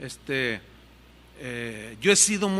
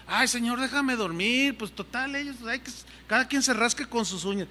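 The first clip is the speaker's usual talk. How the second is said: higher, whiny mock complaint; selfish whine; 5 semitones higher than usual. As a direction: play the grumbling lazy self. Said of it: "Ven, qué egoísmo".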